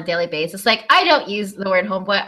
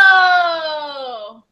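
sudden realization; 5 semitones higher than usual. Real, not acted. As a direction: draw it out as the answer dawns. Song answer finally revealed.

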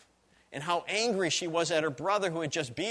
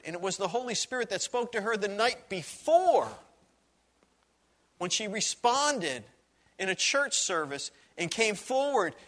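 higher, louder preaching build; rising sermon preaching; 7 semitones higher, 3 dB louder than usual. Acted. Pastor preaching to his congregation, building with fervour. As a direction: drive the point home to the congregation.

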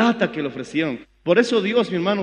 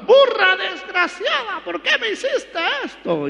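grito agudo, lento y furioso contra la burra; regaña a la burra con rabia; Balaam enojado; 12 semitones higher than usual. Acted. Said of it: "Y estaba enojado Balaam". (Balaam furioso, mucho más agudo, le grita a su burra)

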